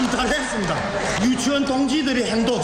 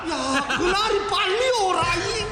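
high, laughing eager cry; excited laughing; 7 semitones higher than usual. Acted. (웃으며 들뜬 목소리)

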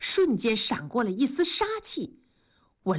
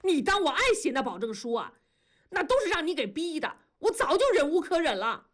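raised-pitch exasperated retort; mild angry yell; 8 semitones higher, faster than usual. A narrator's reading. Husband yells back at his wife, mildly exasperated. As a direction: push back angrily, pitch climbing. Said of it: "同志也喊".

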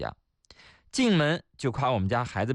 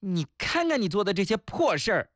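higher-pitched nagging telling-off; scolding wife; 6 semitones higher than usual. Acted. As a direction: play a wife scolding her husband. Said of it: "今天我老婆骂我". (老婆骂人的口气)

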